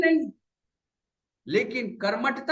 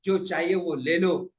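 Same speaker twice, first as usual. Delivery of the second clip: slow, drawn-out beckoning; open-armed invitation, stretched out; nature calling out; 6 semitones lower, slower than usual. Acted. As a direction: call a loved one in, unhurried. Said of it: "बाहें फैलाकर आपको पुकार रही".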